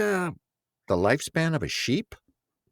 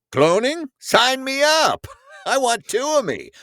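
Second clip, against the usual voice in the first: eager; 14 semitones higher, 4 dB louder than usual. Retold or imitated.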